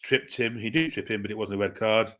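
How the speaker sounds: ordinary talk, nothing marked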